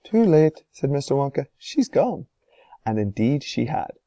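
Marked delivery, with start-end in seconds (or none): 0.0-2.3 lightly voiced Wonka. A narrator's reading.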